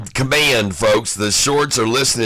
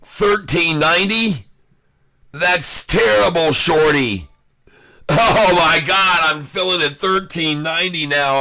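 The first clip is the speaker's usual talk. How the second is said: high-pitched, worked-up disbelief; alarmed excitement; 6 semitones higher than usual. Real, not acted. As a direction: react to the price spiking in real alarm. Price jumps against his short trade.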